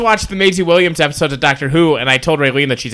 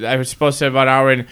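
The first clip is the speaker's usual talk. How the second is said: normal talking voice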